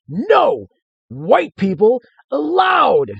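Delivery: high, loud, slow bellow; frantic shout. Acted. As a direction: roar it at the strangers. Hermit raging at expedition.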